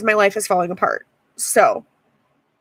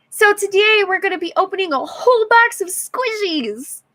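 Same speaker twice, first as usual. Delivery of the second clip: much higher, bright mock unboxing host; mock YouTuber voice; 9 semitones higher than usual. Mocking the YouTube videos that make money, in a much higher mock host voice. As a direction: parody a cheery YouTuber opening squishies.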